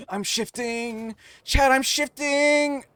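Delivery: high, drawn-out theatrical wail; mock dramatic cry. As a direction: stretch it out like a melodrama. Playful cry to chat.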